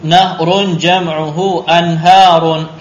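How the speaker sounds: slow, loud singsong recitation; drill reading. Shown as a demonstration. Teacher leading class repetition, slow loud drill voice. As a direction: project each word slowly for repetition. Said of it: "Naqra al kalimatil jadidah".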